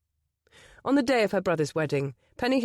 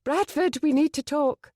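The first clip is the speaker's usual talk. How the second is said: urgent plea; 8 semitones higher, 4 dB louder than usual. A narrator's reading. Penny pleads urgently.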